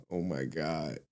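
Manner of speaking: usual speaking voice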